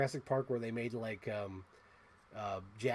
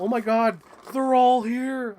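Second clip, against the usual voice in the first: much higher, much louder, slow cry of joy; excited; 10 semitones higher, 12 dB louder, slower than usual. Real, not acted. Collector finding the full Pokemon set, much louder and higher. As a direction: shout it out, thrilled they're all there. Finds complete toy set.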